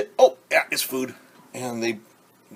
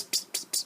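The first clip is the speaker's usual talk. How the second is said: unvoiced puffs of ps-ps-ps like a spray can; spray sound; 10 dB quieter than usual. Teacher showing kids an imaginary spray, unvoiced ps-ps-ps. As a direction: mimic a spray bottle hissing, unvoiced. Imitating ants spraying a smell.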